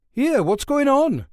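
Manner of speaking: high, loud startled cry; a guard jolted awake in alarm. Acted. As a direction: woken guard blurts out in alarm. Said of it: "he said in some alarm".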